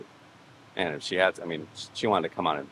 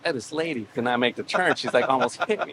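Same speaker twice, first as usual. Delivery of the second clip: retold agitated complaint; 7 semitones higher, 3 dB louder than usual. Retold complaint to phone caller.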